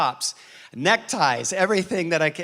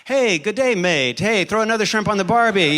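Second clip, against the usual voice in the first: light mock Australian accent, louder; hammed-up Aussie greeting, mildly; a hint of a cheerful Australian bloke; 4 semitones higher, 5 dB louder than usual.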